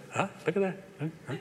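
imitating a hushed nudge to a partner; mock sly aside. Grandfather to Joy over crying grandchild, mock sly aside.

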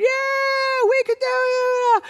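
high drawn-out crowd cheer; hooting and hollering. Retold or imitated. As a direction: holler like an overjoyed congregation. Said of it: "hooting and hollering".

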